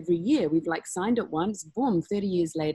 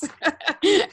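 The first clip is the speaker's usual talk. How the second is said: squealing laugh, much higher and louder; high burst of laughter; 12 semitones higher, 8 dB louder than usual. Real, not acted. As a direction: laugh out loud at the absurd request. Laughing at the snacks request.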